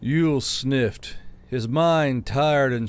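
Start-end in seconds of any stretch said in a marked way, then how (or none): none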